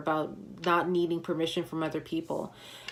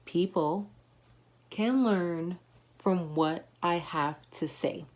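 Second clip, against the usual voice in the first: slow, word-by-word affirmation; slow call-and-response; slower than usual. Shown as a demonstration. Leading viewers in the affirmation, slow and spaced. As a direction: say it slowly for the viewers to follow. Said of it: "Say it with me".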